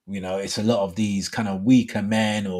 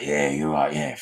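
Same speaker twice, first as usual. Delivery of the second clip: much lower Cockney geezer voice; Cockney big-man; 9 semitones lower than usual. Acted. Host mocking a cocky workmate, low Cockney accent. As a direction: put on a deep Cockney swagger. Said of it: "like, Cockney kind of thing".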